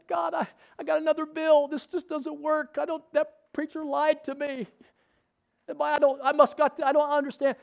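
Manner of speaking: high-pitched mock whimpering complaint; puny whining voice. Preacher acting out griping to God over bills, puny whine. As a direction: play the pitiful, whining victim. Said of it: "That puny voice".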